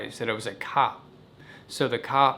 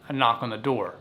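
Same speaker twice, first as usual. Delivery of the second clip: normal talking voice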